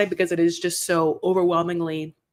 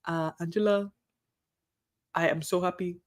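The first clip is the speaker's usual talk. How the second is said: put-on quiet, unconvincing African-accented hostage; half-hearted African accent, hostage claiming happiness; Michael; 2 semitones lower, 5 dB quieter than usual. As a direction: softly attempt a captive's African accent. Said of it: "reenact Michael as a hostage with his African accent".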